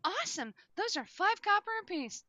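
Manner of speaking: put-on high chirpy old baker; bright sales pitch, high up; grandma voice. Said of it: "So she's like".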